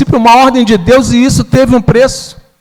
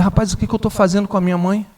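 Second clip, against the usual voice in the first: ashamed; 9 dB quieter, faster than usual. Retold or imitated. Began to cry, ashamed.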